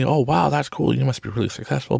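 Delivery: mock awe, pitched well up; impressed onlookers. People assuming he owns a company, gushing in admiration. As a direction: imitate someone gushing over success.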